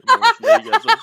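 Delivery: delighted yell of the name; excited shout. Real, not acted. Reading a funny viewer name.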